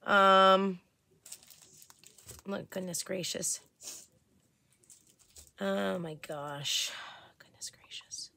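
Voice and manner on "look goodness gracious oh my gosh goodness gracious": softer and softer until it is just a breathy whisper of worry; fading to a whisper. Real, not acted. Reading a worrying message about friends, fading to a whisper. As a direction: let it sink from a low sigh into a whisper.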